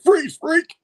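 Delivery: high, loud mock police yell; cop shout. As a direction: order a suspect to halt, shrieking. Cops shout at a suspect.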